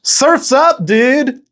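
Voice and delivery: high, stoked surfer call; surfer dude. Pretend. Host greeting viewers as a stoked surfer. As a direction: yell it like a beach bum.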